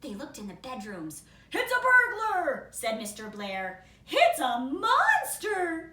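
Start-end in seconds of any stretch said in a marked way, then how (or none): 1.5-2.7 high, loud alarmed cry. Acted. Father bear spotting the intruder, high and loud. 4.1-5.9 high, loud, slow fearful cry; frightened. Acted. Mother bear fearing a monster, high and loud.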